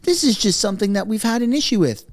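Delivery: high, prissy mock voice of an apologist; mocking analysts. Mocking analysts who defend Trudeau, high put-on voice. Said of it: "some analysts are saying".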